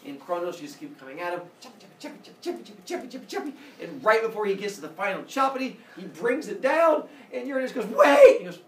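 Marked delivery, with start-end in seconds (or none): 1.6-3.5 hushed, rhythmic chop-chop patter; soft, high staccato chopping noises; chopping sound effect. Acted. Imitates rapid scythe chopping. 7.9-8.4 high, very loud yell; hammed-up last-second plea; mock desperate shout. Uranus begs at cliff edge.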